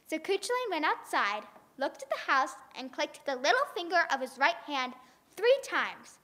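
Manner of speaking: ordinary delivery, nothing marked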